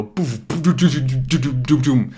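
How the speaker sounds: rapid imitated thuds and bumps; bouncing "doo-doo" rumble; bumpy landing noise. Shown as a demonstration. Teacher imitating a rough plane landing, bumpy noises.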